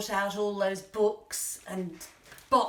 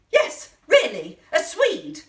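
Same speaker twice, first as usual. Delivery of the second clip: much higher, loud, emphatic exclamation; incredulous; 8 semitones higher, 10 dB louder, slower than usual. Acted. Shopper repeating what they sent instead of fennel, incredulous. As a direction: insist on it in outraged disbelief.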